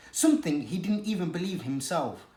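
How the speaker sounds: ordinary delivery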